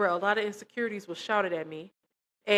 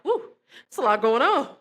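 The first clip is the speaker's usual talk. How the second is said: higher and louder, breathless whew; overwhelmed whew; 4 semitones higher, 6 dB louder than usual. Retold or imitated. Lying back down as everything moves, overwhelmed whew. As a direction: blow out the whew as the room spins.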